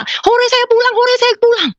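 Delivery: put-on high, childlike cheering; overjoyed. Re-enacting her joy at going home, overjoyed. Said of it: "Saya gembira".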